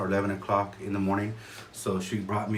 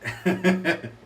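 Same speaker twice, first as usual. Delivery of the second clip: short laugh; 9 semitones higher than usual. Real, not acted. Admiring the big mug.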